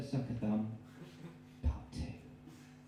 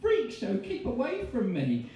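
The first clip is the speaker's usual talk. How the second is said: freaked-out shriek; 12 semitones higher, 3 dB louder than usual. Acted. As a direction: back away screaming at the freak. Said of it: "turned again to Tommy and said".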